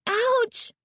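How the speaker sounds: very high pained yelp; shrill ouch of sudden pain; cry of pain. A narrator's reading. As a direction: cry out sharply, hurt. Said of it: "she cried out in pain".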